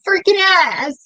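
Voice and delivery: high, laughing mock insult. Real, not acted. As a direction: laugh it out while teasing. Teasing spat over chocolate.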